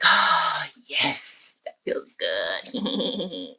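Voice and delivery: low, slow villainous satisfaction with a chuckle; wicked gloating. Acted. Boy after tearing up homework, low gloating. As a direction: savor the mischief, then snicker.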